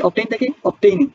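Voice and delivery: "obtaining" said slowly and carefully; slow pronunciation demo. Shown as a demonstration. Teacher slowly models the ing form. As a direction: spell out "obtain থেকে obtaining" slowly for students. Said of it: "obtain মানে কি?".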